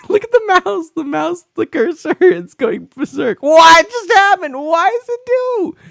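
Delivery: high, loud, squealing astonishment; hysterical laughing disbelief. Real, not acted. Streamer squealing to chat as the cursor goes berserk, high and loud. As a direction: lose it laughing at the crazy cursor.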